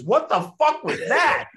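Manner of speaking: loud, very high-pitched disbelieving yell; shocked outburst. Retold or imitated. Actor reacting to fart in his face mid-take, shocked high yell. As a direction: shriek it in outraged shock. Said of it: "what comes out of my mouth".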